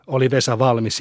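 plain reading voice

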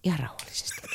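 "ihan rauhallisesti" whispered softly to calm. Pretend. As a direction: hush the caller with "ihan rauhallisesti".

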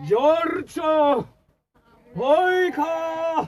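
father calling down the cliff to his son. Acted. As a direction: call out to him, afraid of the answer. Father calls down after the fight.

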